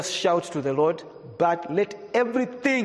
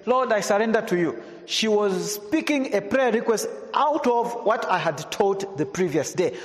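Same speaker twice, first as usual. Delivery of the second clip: raised, high-pitched preaching voice, pressing the story forward; fervent preaching; 7 semitones higher than usual. Acted. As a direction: preach it out with fire to the congregation. Animated storytelling in sermon.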